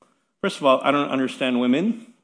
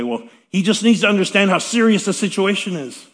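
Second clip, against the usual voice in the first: higher, louder mock of an upset wife; a wife insisting, pitched up and louder; imitating the wife; 6 semitones higher, 7 dB louder than usual. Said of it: "and the wife would say".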